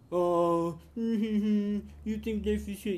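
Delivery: high, loud sneering mock voice; mocking quote. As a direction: sneer it back like you're taunting him. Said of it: "You start mocking us".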